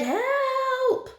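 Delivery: very high, thin squeal; mock-terrified squeak for rescue; panicked cry. A narrator's reading. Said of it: "the other one cried".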